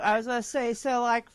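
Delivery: ordinary delivery, nothing marked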